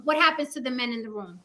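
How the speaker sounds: ordinary talk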